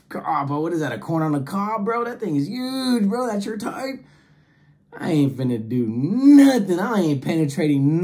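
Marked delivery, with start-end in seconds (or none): 0.1-4.0 higher, incredulous bro voice; shocked disbelief. Retold or imitated. Telling his date, stunned and high-pitched. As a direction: recoil at the size of it. Comedian voicing himself shocked at toy.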